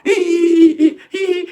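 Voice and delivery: mock high-pitched mocking giggle; imitating people laughing at a mistake. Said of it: "Mais l'homme noir".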